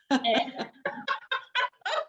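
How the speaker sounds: high burst of laughter at herself; sheepish giggle rising high; self-mocking laugh. Real, not acted. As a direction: laugh at your own rambling.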